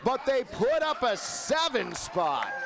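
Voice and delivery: lively radio baseball call; play-by-play. Acted. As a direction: call the action live.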